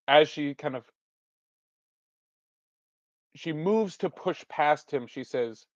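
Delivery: ordinary delivery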